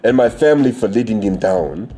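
put-on reading in a Nigerian accent. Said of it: "read that shit in my Nigerian voice".

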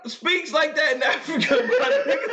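high, laughing incredulous shout; cracking up while calling out the absurdity; laughing disbelief. Real, not acted. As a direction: yell it in amused disbelief. Incredulous rant about Lion King accents.

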